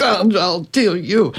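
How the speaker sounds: mock an offended biologist spluttering; indignant Rupert Sheldrake. Imitating Rupert Sheldrake reading the article, huffy.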